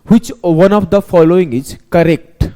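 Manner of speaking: very slow, drawn-out question reading; exam question read out. Retold or imitated. Teacher mimicking the exam paper, very slow and deliberate. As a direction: spell out each word like an exam paper. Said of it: "मैं आपको दिखाता हूं कैसे बोलेगा ना".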